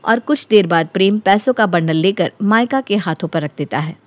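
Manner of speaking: ordinary delivery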